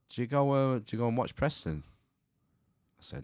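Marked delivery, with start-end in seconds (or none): 0.1-1.8 raised-voice quote of a shout across the room; shouting over. Retold or imitated. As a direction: call it over, higher and louder. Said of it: "he shouts over".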